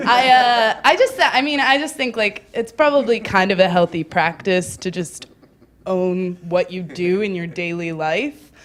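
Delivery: normal talking voice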